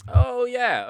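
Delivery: excited oh yeah. Real, not acted. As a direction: burst out, impressed by what you see. Reacting on seeing a character picture.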